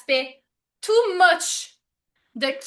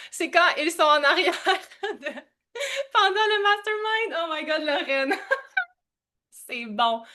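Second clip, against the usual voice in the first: talking through real laughter; cracking up; 3 semitones higher than usual. Real, not acted. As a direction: laugh helplessly through the words. Cracks up at children's remark.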